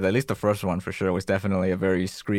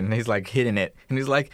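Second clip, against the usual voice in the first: ordinary talk, nothing marked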